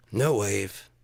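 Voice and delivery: normal talking voice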